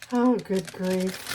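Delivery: loud, drawn-out good grief; slow, loud, fed up; exasperated groan. Real, not acted. Icing spilling everywhere.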